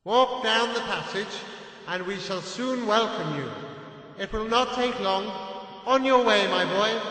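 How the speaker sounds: calm him and explain the plan; uncle's voice. A narrator's reading.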